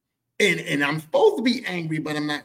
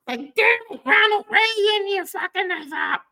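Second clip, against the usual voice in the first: mock outrage; 5 dB louder, slower than usual. Blaming Reagan in mock outrage.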